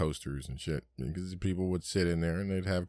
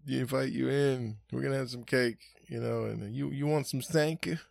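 mimicked host; 7 semitones higher than usual. Host offering coffee.

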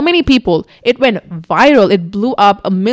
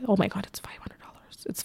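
hushed whisper, the second line quicker; a secret whispered to the camera; 15 dB quieter than usual. Real, not acted. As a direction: whisper it as if embarrassed. Shocked aside about the small sum.

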